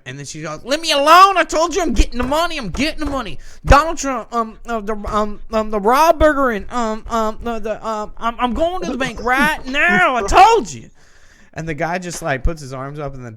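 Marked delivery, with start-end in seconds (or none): 0.6-10.9 high-pitched, yelled outburst with stumbling babble; crazy crackhead. Retold or imitated. Imitating bus passenger snapping at the man approaching her, crazed yelling. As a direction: explode at a stranger, shouting far above your usual pitch. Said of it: "crazy crackhead was on the bus".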